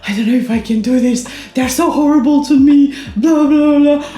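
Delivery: distressed crying. Retold or imitated. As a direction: pour it out to a friend, close to tears. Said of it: "I was crying and I was like".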